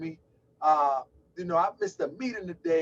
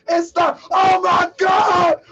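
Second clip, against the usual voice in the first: high, loud, slow shouted welcome; excited shout; 10 semitones higher, 12 dB louder, slower than usual. Real, not acted. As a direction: hail her arrival at full voice. Hyped greeting to a viewer arriving.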